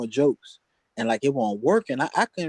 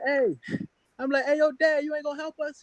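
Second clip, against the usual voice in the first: calling out to dad; 13 semitones higher than usual. Retold or imitated.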